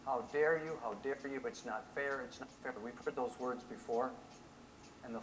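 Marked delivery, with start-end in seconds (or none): none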